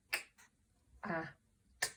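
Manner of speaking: k, a, t as three separate short sounds; phonics sounding out. Shown as a demonstration. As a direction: sound out "k, a, t" with clear gaps. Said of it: "how we blend those phonemes".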